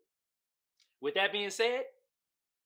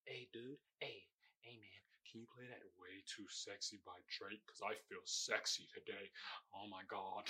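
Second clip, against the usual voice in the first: sultry club guy; 10 semitones lower, 18 dB quieter than usual. Retold or imitated. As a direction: purr the request to the DJ like you feel sexy. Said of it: "that's how it sounds in my head".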